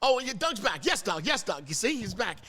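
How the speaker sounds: usual speaking voice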